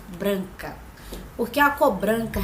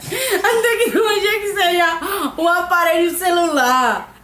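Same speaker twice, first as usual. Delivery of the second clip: laughing; 5 semitones higher, 4 dB louder than usual. Real, not acted. Laughing through the answer.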